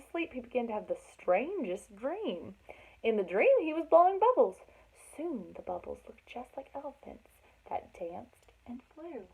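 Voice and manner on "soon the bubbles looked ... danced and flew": much softer, fading to a whisper; dreamy hush. Acted. Reading the dream to children, drifting into a whisper. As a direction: let it float off like a dream.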